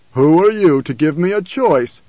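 put-on loud, querulous old autocrat; Baron Harkonnen. A narrator's reading. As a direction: demand it with imperious scorn. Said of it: "still the voice of the old Baron".

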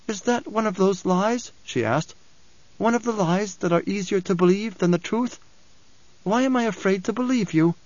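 high, searching woman's voice; doubting Alia. A narrator's reading. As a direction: question him anxiously in a high voice. Alia's voice in narration.

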